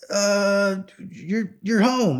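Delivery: high, slow, hesitant surprise; retold astonishment. Calling his friend who unexpectedly answers at home, high and taken aback. Retold shocked phone call.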